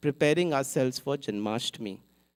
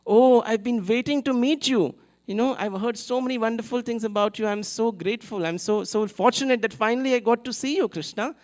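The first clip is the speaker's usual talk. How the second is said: overjoyed greeting; 7 semitones higher than usual. Retold or imitated. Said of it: "He said".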